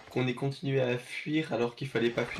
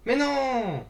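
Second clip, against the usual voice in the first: high, loud despairing yell; frustrated shout; 12 semitones higher, 6 dB louder than usual. Real, not acted. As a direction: cry out in disbelief. Dino runs off with threat.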